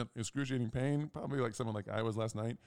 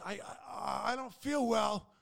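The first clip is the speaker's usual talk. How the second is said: high, louder whimpering voice; sick teammate; 12 semitones higher, 5 dB louder than usual. Retold or imitated. Teammate in pain, a high whimpering mock voice. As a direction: whine it like a sick friend. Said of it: "he goes".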